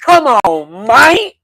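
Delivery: loud, high exasperated "come on, man"; Boozy catchphrase. Retold or imitated. To his chat, repeating the Boozy bit, loud and high. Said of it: "That's my Boozy impersonation".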